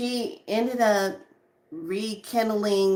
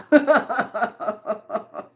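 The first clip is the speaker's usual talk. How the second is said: loud, high burst of real laughter; long rolling laugh; hearty laugh; 4 semitones higher, 5 dB louder than usual. Real, not acted. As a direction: crack up at your own story. Cracks up at own story.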